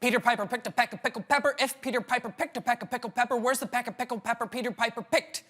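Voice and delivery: rattled off very fast; rushed tongue twister. Shown as a demonstration. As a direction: race through the tongue twister. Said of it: "and quicker".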